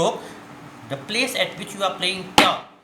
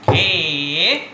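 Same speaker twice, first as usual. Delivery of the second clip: GHE held long and firmly; drawn-out syllable call; 5 dB louder than usual. Shown as a demonstration. Teacher demonstrates the stroke, syllable drawn out. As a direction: call out a sustained tabla syllable. Calls syllable for pupils to repeat.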